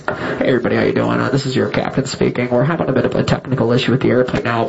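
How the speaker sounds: low, easygoing pilot announcement; captain voice. Retold or imitated. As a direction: play the calm captain on the PA. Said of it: "she wanted to hear something like this".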